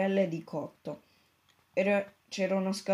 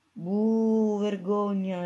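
booing crowd, raised; 2 semitones higher, 4 dB louder, much slower than usual. A narrator's reading. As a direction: boo like an angry crowd. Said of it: "rispose la folla".

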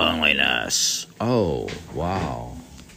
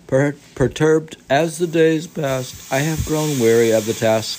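raised, careful reading voice; reading the old poet's journal aloud, a little higher; literary reading; 10 semitones higher, 8 dB louder than usual. Acted. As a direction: read it like a translated diary. Reads Basho text aloud.

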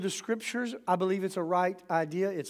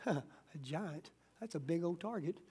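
scoffing, unafraid; 3 semitones lower, 12 dB quieter than usual. Retold or imitated. David scoffs at the giant.

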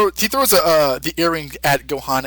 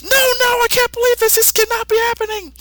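very high cartoon wail of disbelief; horrified Goku. Goku seeing Gohan absorbed, high and horrified. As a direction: squeal it out in horror. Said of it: "and says".

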